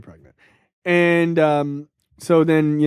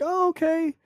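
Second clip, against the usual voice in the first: higher, meek sweet-lady reply; sweet wife voice; 10 semitones higher, 5 dB quieter than usual. Retold or imitated. Wife meekly accepts her husband's excuse, in a higher sweet voice. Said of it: "a sweet lady".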